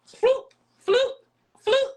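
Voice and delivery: playful slip-off sound effect; sliding noise. Retold or imitated. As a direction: make the slippery sliding-off noise. Darts sliding off in vision.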